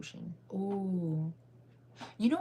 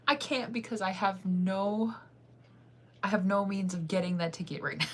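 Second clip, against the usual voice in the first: ordinary talk, nothing marked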